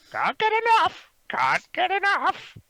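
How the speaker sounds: very high, loud squawked mimicry; talking crow. Crow repeating its owner's words, squawking. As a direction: screech it like a parroting crow. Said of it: "He goes".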